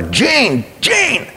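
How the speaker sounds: high-pitched call to wake someone; imitating nurses yelling a patient's name; retold yell. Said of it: "they'd yell at him".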